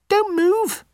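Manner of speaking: very high, squeaky little mouse; a small mouse taking charge; tiny mouse voice. Acted. Mouse telling the trapped lion to stay still, very high and squeaky.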